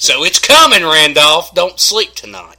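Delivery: mock threat.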